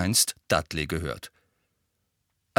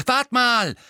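high, loud cut-in; loud interruption; 5 dB louder than usual. A narrator's reading. Twin loudly cutting off Sirius, high and loud. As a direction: break in sharply. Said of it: "warf George laut ein".

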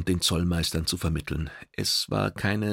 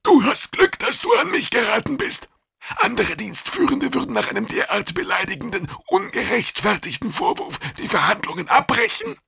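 high-pitched, huffy creature voice; indignant alien official; 4 dB louder than usual. A narrator's reading. Offended Kidang warning the bird, high and indignant. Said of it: "mit erbost sirrenden Geweidzangen".

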